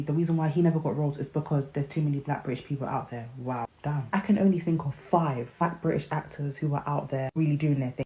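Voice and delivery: ordinary talk, nothing marked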